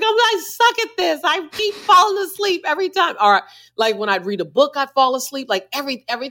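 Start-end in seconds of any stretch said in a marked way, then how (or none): 0.0-3.2 high-pitched mock whine of frustration; exasperated, squeaky retold self-scolding; self-reproach. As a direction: beat yourself up in a much higher voice. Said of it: "I would feel bad".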